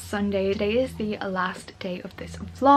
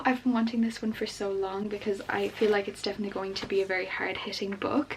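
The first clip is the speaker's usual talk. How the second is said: ordinary talk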